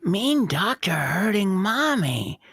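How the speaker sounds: put-on slow, soft little-kid voice; a hint of a small boy whispering; slow childlike whisper to his dad; child whisper. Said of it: "Dargo whispers to his dad".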